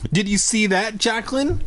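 put-on high-pitched girl's taunting cry. A narrator's reading. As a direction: call it out high, rising at the end. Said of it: "she cried".

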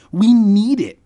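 high, loud, emphatic declaration; declared. A narrator's reading. Boy insisting they need the banner, loud declaration. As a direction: declare it forcefully, pitched right up. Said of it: "I declared".

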